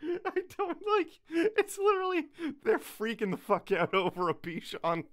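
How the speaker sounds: high, squeaky talk through laughing; helpless laughter. Real, not acted. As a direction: barely get the words out for laughing. Cracking up at the scene.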